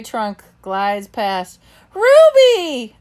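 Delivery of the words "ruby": high, loud happy call; joyful exclamation. A narrator's reading. Bob spots Ruby in the water and calls out gladly. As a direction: shout her name with delight. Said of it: "I exclaim".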